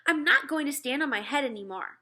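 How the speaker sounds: put-on older brother refusing his mother, firmly; boy refusing. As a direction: put your foot down like a fed-up boy.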